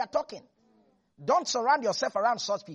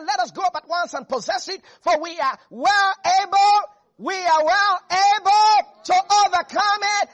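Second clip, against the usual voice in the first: loud, high, building proclamation; loud rising cry of faith; bold declaration; 7 semitones higher, 9 dB louder than usual. Acted. Caleb's faith declaration, preached.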